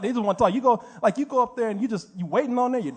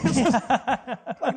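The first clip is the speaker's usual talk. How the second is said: normal talking voice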